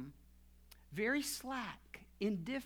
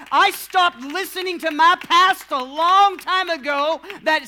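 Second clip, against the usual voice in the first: full-force preaching, loud and raised in pitch; 6 semitones higher, 16 dB louder than usual. Real, not acted. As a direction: preach it out loud with driving intensity.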